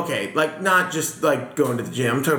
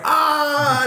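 put-on kid's yelp; 9 semitones higher than usual. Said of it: "I was like".

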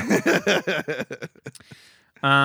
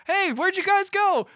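very high, slow, puzzled calling; mock lost hero; slower than usual. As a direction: call out, comically lost.

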